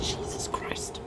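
breathy whispered curse. Real, not acted. Gamer hiding from players, whispering. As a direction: hush it so they don't hear.

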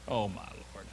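very low, soft groan of words; weary disbelief. Acted. Gideon replying to the messenger, weary disbelief. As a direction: sigh it out under the voice.

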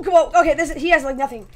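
ordinary talk

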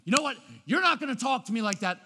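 sharp loud rebuke; angry parent. Shown as a demonstration. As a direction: snap back at the kid in anger. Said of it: "rather than".

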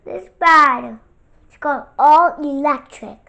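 loud, upset outburst. Real, not acted. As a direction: blurt it out loudly. Loud outburst over a card.

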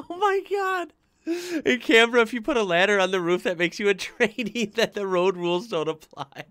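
high-pitched voice, full of laughter; laughing. Real, not acted. Reading a Canberra road rules joke, laughing. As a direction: read it out while cracking up.

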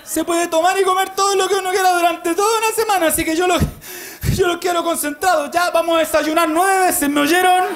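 father shouting to family. Retold or imitated. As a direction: whip the family up like a crazed dad. Father rallying family at hotel.

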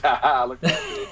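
lightly voiced cheeky horse; smug cartoon horse. A hint of the winking cartoon horse teasing viewers. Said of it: "It's like".

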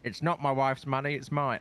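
a hint of a Tory prime minister defending himself; Rishi Sunak impression. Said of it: "what would, uh, Rishi Sunak say".

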